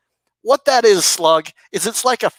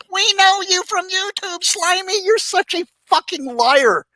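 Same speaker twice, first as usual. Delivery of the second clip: higher, taunting stranger's voice; mock a stranger calling him a liar; mock accusing stranger; 9 semitones higher than usual. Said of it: "walked up to your window and said".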